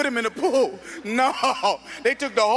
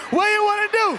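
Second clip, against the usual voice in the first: high, loud fight taunt; shouted challenge; 10 semitones higher, 4 dB louder than usual. Retold or imitated. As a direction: yell the dare louder. Repeated louder challenge.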